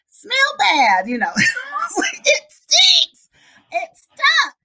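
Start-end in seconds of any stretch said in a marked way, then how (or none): 0.1-1.1 put-on high, loud child voice; complaining little boy. Boy explaining his upset in the car, complaining. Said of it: "he said". 2.2-4.5 put-on very high, loud kid squeal; squealing complaint. Boy protesting the smell in the car, squealing.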